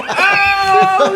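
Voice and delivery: high laughing oh; laughing exclaim. Retold or imitated. Kids mocking her dress, high laughing oh. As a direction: laugh out the kids' reaction. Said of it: "They were like".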